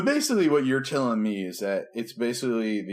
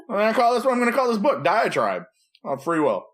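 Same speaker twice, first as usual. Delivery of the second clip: mock author voice; 8 semitones higher, 3 dB louder than usual. Mocking the author Erasmus.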